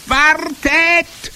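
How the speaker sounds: very high, loud witch shriek; piercing scream. A narrator's reading. Witch in the last row halts everyone, screaming. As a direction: scream it like a trumpet blast. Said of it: "schrie eine der Hexen".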